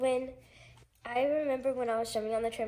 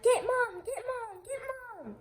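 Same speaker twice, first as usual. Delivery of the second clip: imitated urgent cry; 12 semitones higher, slower than usual. Child retelling a friend's call after the accident, imitated urgent cry. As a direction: act out a child calling for help, trailing away. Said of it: "Merritt was like".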